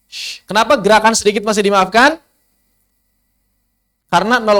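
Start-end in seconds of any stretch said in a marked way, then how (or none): 0.1-0.4 a soft hushing "shh", lips slightly moving; contoh bunyi shin. Shown as a demonstration. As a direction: demonstrate a rounded "shh" sound. (bunyi "shh" lirih dengan bibir sedikit bergerak)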